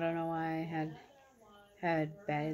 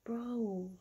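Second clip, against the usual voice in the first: shocked outrage; 7 semitones higher than usual. Retold or imitated. To the boy reaching for her hand, high shocked protest. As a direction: replay the shock of his move in a higher voice. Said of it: "And I was like".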